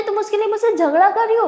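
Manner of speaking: you're fighting me for her?; indignant disbelief. Acted. Sister sides against her.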